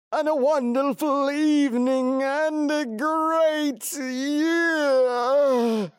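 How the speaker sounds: very high, slow parody game show host; hammed-up high welcome, stretching the words to a long held finish.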